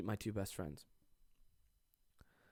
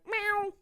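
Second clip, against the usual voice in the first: much higher playful meow. Pretend. Meowing playfully at his cat Mia.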